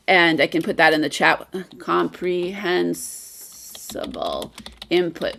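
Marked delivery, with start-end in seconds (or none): 1.8-5.4 drawn-out, under-the-breath spelling pace; slowly sounding out. Real, not acted. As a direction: murmur it slowly while typing it out. Typing it into the chat.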